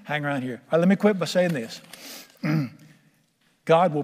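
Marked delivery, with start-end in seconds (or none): 0.0-0.6 soft, gentle fatherly voice, much quieter; tender voice of God. Acted. God gently reassuring his son, soft and tender. Voicing God speaking to him.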